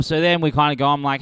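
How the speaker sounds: ordinary talk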